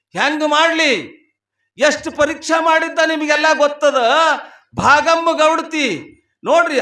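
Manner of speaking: high, projected sermon storytelling; full-voiced preaching. Real, not acted. Preacher telling devotees of the husband's illness, full-voiced preaching. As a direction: tell the legend out to a big hall.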